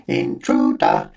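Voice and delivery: slow mechanical alarm voice; robot voice. A narrator's reading. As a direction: blat it like a stuck machine. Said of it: "a mechanical voice blatting".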